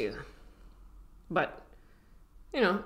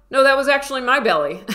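mock insistence; 9 semitones higher, 11 dB louder, faster than usual. Imitating someone claiming the growl.

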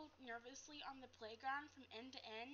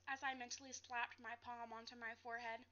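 ordinary delivery

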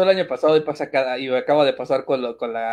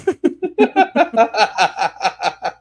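a long high burst of laughter; real laughter, clearly higher; 7 semitones higher than usual. Real, not acted. Laughing at own joke.

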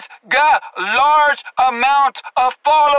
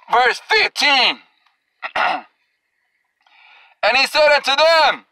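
usual speaking voice

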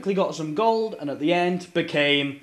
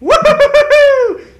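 loud high cheer; excited whoop; 15 dB louder than usual. Real, not acted. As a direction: whoop with triumph. Celebrating hit in battle.

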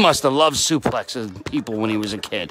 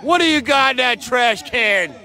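excited commentary; 6 semitones higher than usual. Real, not acted. Wrestler passing with trash can.

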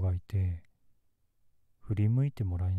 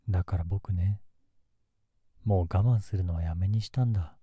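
menacing. Acted. Possessive threat.

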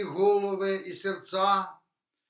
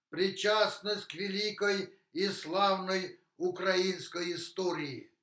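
ordinary talk

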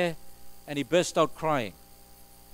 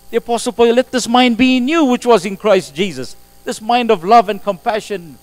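louder, higher, ringing pulpit voice; full-force preaching; 6 semitones higher, 10 dB louder than usual. Acted. Pastor proclaiming Paul's words to the congregation, loud and ringing. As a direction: proclaim the verse to the back row.

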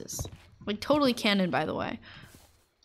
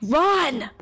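louder, urgent playful yell; mock warning shout; 3 semitones higher, 5 dB louder than usual. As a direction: shout it like a fire alarm. Urging viewers to flee.

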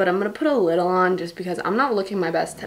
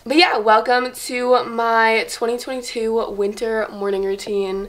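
ordinary talk, nothing marked

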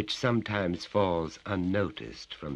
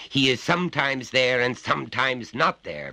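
lecturing father, raised and higher; stern father; 8 semitones higher, 7 dB louder than usual. Acted. Father lectures his little son, stern and raised. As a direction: talk down to the child from on high.

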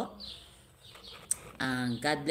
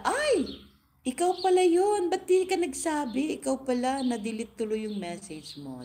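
high, loud mock surprise at being recognized; mock surprised gasp; 10 semitones higher, 4 dB louder than usual. Host voicing embarrassed chat members, a squealing mock surprise. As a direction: squeal it like a caught-out fan. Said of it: "Sabi nila".

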